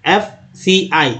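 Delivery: "एफ़ सी आई" slowly, a pause after each letter. Shown as a demonstration. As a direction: spell out "एफ़ सी आई" one letter at a time. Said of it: "आपको single single उच्चारण करना है". ("एफ़ सी आई" एक-एक अक्षर अलग, धीरे)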